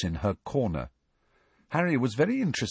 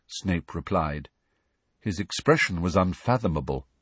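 ordinary delivery

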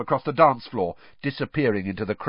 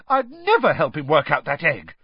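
louder, indignant denial; outraged; 6 semitones higher, 5 dB louder than usual. A narrator's reading. Hermione denying she'd help Krum, outraged. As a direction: refuse the insult hotly. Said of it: "looking outraged".

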